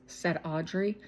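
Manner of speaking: ordinary delivery, nothing marked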